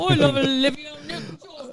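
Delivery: mock Australian shout. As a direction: yell it like a cartoon Aussie. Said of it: "Do Australian".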